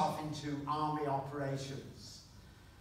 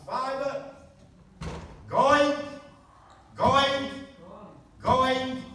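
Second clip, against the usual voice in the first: loud, higher auctioneer chant; auctioneer's call; 7 semitones higher, 7 dB louder, slower than usual. Acted. Auctioneer calls the sale to the room, loud and higher. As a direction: call the lot out across the room.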